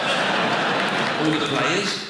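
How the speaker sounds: loud drawn-out outrage; drawn-out rant. Acted. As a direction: wind up the rant. Ranting build-up.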